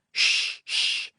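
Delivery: urgent hushing shh; shushing. A narrator's reading. Frenchman hushing the giggling pilot, urgent shushing. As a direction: hush him with a sharp shh. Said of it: "The Frenchman said".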